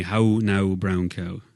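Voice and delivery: rural Irish with rounded "How now" vowels; a country Irish "brown cow"; Irish country accent. Shown as a demonstration. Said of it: "every vowel would have an ooh at the end".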